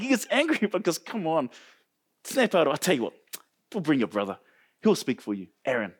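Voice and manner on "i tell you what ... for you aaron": a low, gentle God voice, softly settling it; lightly voiced God, quiet and patient; God's calm anger. God offering Moses a brother instead, low and gentle. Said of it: "this is what God's anger looks like".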